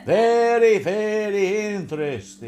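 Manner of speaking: loud, high, drawn-out "very"; comic catchphrase. Acted. Host repeats a pet phrase, comic catchphrase voice. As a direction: put on a slow, exaggerated comic voice.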